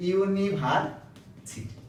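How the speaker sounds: ইউনিভার্সিটি drawn out syllable by syllable, very slow; a teacher slowly sounding out a word. Shown as a demonstration. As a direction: spell out ইউনিভার্সিটি slowly by sound so the first sound is heard. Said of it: "এখন আপনি চিন্তা করতেছেন".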